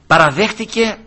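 normal talking voice

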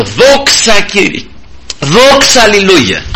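high, drawn-out cry of praise; re-enacted hallelujah cry; exultant praise. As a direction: lift the voice high in thanks to God. Acts out praise with raised arms.

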